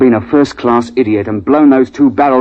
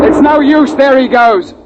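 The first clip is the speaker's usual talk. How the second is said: urgent shouting; 13 semitones higher than usual. Acted. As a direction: shout as the beast escapes. Shouting as beast escapes.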